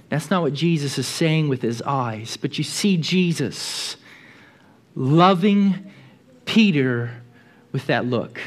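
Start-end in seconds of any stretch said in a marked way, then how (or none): none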